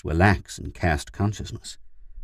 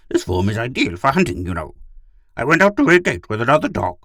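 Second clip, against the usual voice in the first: high, loud, self-satisfied talking dog; breezily complacent in a raised voice; 7 semitones higher, 6 dB louder than usual. A narrator's reading. Gur explains himself to Pid, complacent. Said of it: "Gur said complacently".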